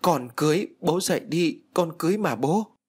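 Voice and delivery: restrained narrator's wail; crying out. A narrator's reading. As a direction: call out desperately to a father. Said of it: "và gào lớn".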